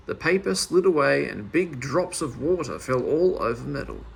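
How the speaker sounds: plain reading voice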